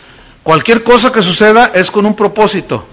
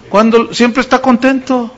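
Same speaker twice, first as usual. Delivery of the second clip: ordinary delivery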